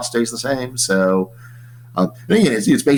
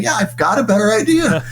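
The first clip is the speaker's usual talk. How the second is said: much higher, eager and confident; eager claim; 11 semitones higher than usual. Retold or imitated. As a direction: pipe up with a better idea, keen. Economists answering complainers.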